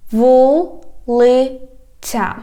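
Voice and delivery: "Вулиця" very slow, broken into syllables. Shown as a demonstration. Teacher has learners repeat, "Вулиця" slowly by syllables. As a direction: stretch each syllable of "Вулиця", pausing between. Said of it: "divide it into syllables".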